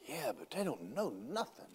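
lightly acted confidential reply, pitch swooping; Jesus. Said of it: "Jesus looks at him and goes".

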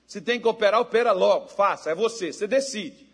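loud, high urging preacher; forceful preaching. Acted. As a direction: drive the point home loudly.

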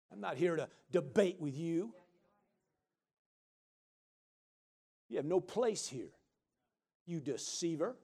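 low, soft, unbothered command; quiet rebuke. Acted. Child of God to the devil, quietly dismissive. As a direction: dismiss him without raising your voice.

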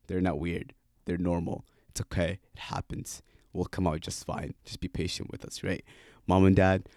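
ordinary delivery

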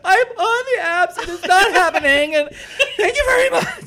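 half laugh, half mock sob; laugh-crying wail. Telling the hug guy about dating apps, laugh-crying wail.

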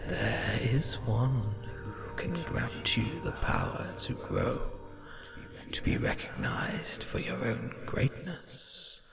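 soft, unvoiced tempting whisper; ghost voice. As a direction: lure him in, barely breathed.

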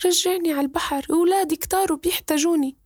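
high, squeaky little fish voice begging; a small talking fish pleading to go home. Acted. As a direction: beg like a tiny magic fish.

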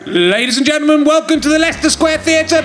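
high, rising show introduction; stage announcer. Acted.

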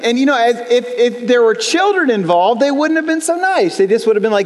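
ordinary talk, nothing marked

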